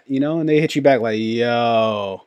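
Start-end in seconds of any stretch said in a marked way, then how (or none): 1.2-2.2 flat yo. Retold or imitated. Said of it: "hits you back with that, "Yo".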